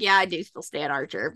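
resigned admission. Retold or imitated. Fan admitting she still likes Archer, low and resigned. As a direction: drop down and concede it. Torn fan answers own doubt.

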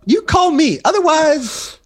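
high, gloating brag with a finishing blow; mock cocky taunt. To rival photographers, a mock gloating taunt. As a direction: crow it like you just won at chess.

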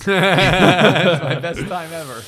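loud, slow, hammed-up boast; mock triumph. A podcaster hamming up his cube solve, loud slow gloat.

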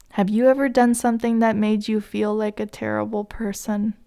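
usual speaking voice

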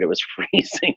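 breathy laugh through the words; amused, half-laughed. Real, not acted. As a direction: laugh it out, remembering the cold. Laughing recalling freezing arrival.

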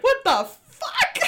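high, loud, drawn-out mock disbelief; shocked outburst. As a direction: cry out in hammed-up bafflement, half laughing. Acting out own shocked reaction.

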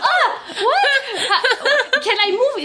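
high laughing panic. Retold or imitated. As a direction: squeal it out through laughter. Panicking under needles, retold.

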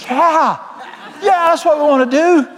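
excited shout. Retold or imitated. Preacher answering his friend with a high shouted yes. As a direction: whoop the yeahs like a revival crowd. Enthusiastic agreement in retold lunch.

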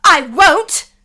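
loud, high refusal; forceful, defiant retort. Acted. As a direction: shout back and stand your ground. Alice defies the furious Queen.

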